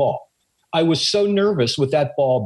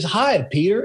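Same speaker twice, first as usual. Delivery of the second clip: higher-pitched imitation of an Oklahoma drawl; 6 semitones higher than usual. Baseball idol greets a starstruck producer at lunch, Oklahoma drawl. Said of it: "He has a Oklahoma drawl".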